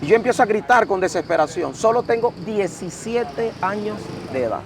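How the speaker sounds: normal talking voice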